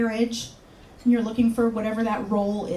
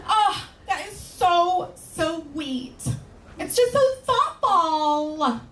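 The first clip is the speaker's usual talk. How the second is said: higher, very slow, swooning coo; swooning coworkers; 6 semitones higher, much slower than usual. Retold or imitated. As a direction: melt over how sweet it is. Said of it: "they go".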